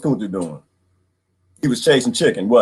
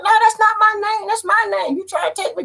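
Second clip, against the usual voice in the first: high, defiant protest of a captive; defiant refusal. Retold or imitated. Captive refusing the name given by colonizers, high and defiant.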